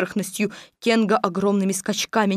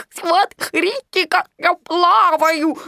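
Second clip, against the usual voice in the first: high, slow, delighted; happily gurgling Roo; 9 semitones higher, 3 dB louder, much slower than usual. A narrator's reading. Roo still in the water, joyful high gurgle. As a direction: burble with joy. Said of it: "продолжал радостно булькать".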